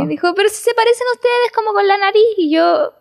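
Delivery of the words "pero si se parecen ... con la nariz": put-on high, quick little kid voice; child's remark. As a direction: chirp it like a small boy. Child's remark about the pigs. (voz de niño pequeño, aguda y rápida)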